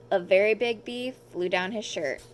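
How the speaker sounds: ordinary delivery, nothing marked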